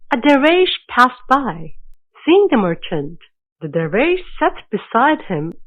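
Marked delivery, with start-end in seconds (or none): none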